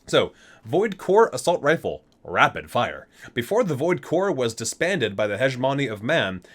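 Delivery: ordinary delivery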